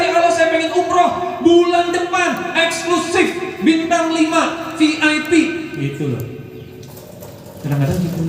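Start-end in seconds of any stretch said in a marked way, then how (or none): 0.1-5.3 bold confident prayer. Retold or imitated. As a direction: ask for everything with full confidence. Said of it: "Kalau yakin minta yang besar".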